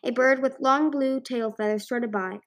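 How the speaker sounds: ordinary delivery, nothing marked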